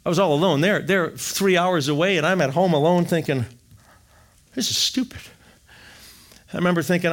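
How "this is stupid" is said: high whispered scorn; whispered bitter thought; muttered disgust. Retold or imitated. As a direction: spit it under your breath. Angry thought alone at home.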